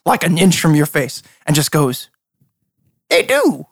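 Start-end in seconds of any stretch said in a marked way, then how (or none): 3.1-3.7 very high, cooing reply; teasing, pitched right up; coy lord. Acted. Lord inches from dragon's face, coy.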